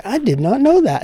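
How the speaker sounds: mock-formal deadpan quip; Johnny Carson catchphrase. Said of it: "I'd say".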